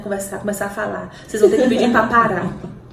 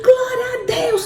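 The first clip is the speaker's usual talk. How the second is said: praise shout; 14 semitones higher than usual. Retold or imitated. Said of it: "Paulinha foi gritando".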